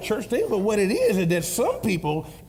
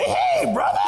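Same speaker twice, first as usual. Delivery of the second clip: excited greeting; 3 dB louder, slower than usual. Retold or imitated. Grandpa acting excited greeting.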